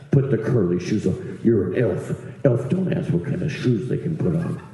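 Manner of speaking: low-pitched imitation of a boss; unbending boss. Boss ordering an elf into curly shoes, low dismissive voice. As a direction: lay down the rule, pitched low. Boss overruling an elf's complaint.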